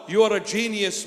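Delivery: ordinary delivery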